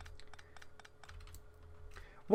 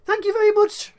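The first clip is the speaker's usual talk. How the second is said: sudden high, loud squeak of thanks; much higher and louder, a bashful squeal; delighted thanks; 12 semitones higher, 5 dB louder than usual. Real, not acted. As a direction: yelp it out, flattered. Flattered by compliment.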